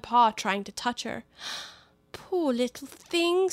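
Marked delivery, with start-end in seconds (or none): 2.1-3.5 slow, high and gentle coax. Acted. Alice coaxing the enormous puppy, slow and gentle. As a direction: soothe the puppy slowly. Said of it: "in a coaxing tone".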